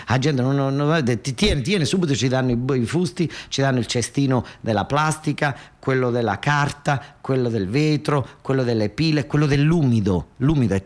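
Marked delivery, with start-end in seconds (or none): none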